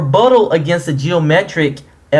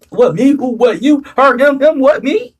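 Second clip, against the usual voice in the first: mock innocent; 10 semitones higher than usual. Mocking innocent confusion.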